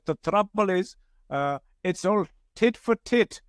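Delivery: light Indian accent impression, amused. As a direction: mimic the young Indian man's Indian accent, slowing on tit for tit. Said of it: "He said in his Indian accent".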